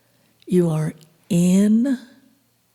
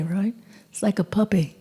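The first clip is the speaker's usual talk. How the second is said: usual speaking voice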